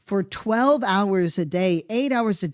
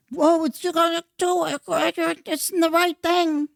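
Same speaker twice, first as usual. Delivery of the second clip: mocking voice; 12 semitones higher than usual. Mocking the left's pitch.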